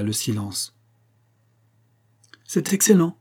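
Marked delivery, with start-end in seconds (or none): 2.4-3.2 high, eager cry of approval; delighted exclamation. A narrator's reading. Choirmaster applauding the doctor's tale, delighted exclamation. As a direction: exclaim it, pitched well up. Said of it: "s'écria le maître de chapelle".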